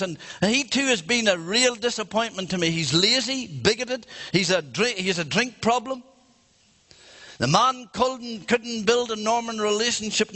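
high-pitched, acted disapproving old woman; elderly grandmotherly woman. Old witness denouncing the defence lawyer, acted indignation. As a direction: reel off the faults in mock indignation. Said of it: "an old grandmotherly, elderly".

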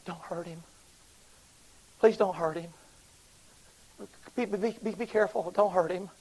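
pleading through tears. Retold or imitated. Prisoner begging the preachers to handle the picture gently, pleading. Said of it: "quivering and shaking".

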